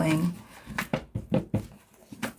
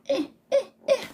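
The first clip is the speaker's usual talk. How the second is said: mock crying; faster than usual.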